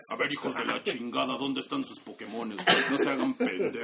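gruff commander chewing out the squad; a hint of a tough military boss; wrestling promo. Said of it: "Es como, es como Nick Fury".

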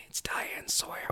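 hushed, voiceless aside; acted whisper. Acting out Cotton in his retelling, whispered. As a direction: whisper it like a secret.

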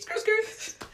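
very high squealing skrrt; mock tire screech. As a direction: screech like tires spinning out.

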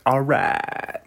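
usual speaking voice

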